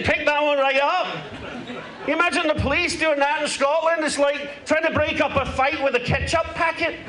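mock Glaswegian comic voice, played broad; a lightly held Scottish accent; put-on Scottish accent. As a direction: talk like a brash Scotsman fresh off the plane. Holds a put-on Scottish accent until admitting he's London-born.

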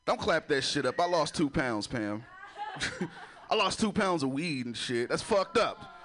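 ordinary talk

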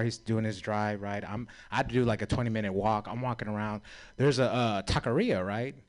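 ordinary talk, nothing marked

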